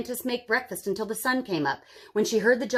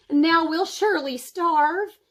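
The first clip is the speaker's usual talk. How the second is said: despairing; 7 semitones higher, 5 dB louder, slower than usual. A narrator's reading. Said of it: "she cried".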